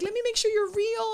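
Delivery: high, loud tearful squeak; imitated bawling. Fan hugging her idol, bawling. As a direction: mimic a fan crying in disbelief. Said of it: "she just starts bawling".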